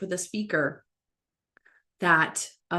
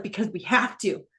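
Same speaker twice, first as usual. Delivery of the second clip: retold tearful plea; 5 semitones higher, 5 dB louder than usual. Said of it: "he's crying".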